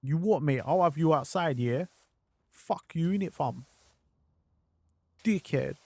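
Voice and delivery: mock-aggressive London street voice; hammed-up roadman picking a fight; rough London accent. Mocking how tough English characters talk, hammed-up London accent. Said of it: "or they sound like".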